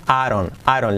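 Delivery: "Aaron": "I don't" run together with a tapped R; soft R linking. Shown as a demonstration. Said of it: "Como una R suave". ("Aaron", la D como R suave)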